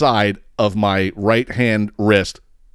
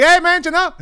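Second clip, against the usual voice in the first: much higher, cheery hail to a stranger; calling out for help; 3 dB louder than usual. Retold or imitated. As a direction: sing out "hey man" to get his attention. Calling to passing neighbor.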